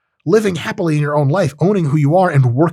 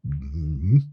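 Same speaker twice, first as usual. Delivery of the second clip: quiet mm-hmm; 10 semitones lower, 6 dB quieter than usual. Acted. Woman's approving reply, acted quote.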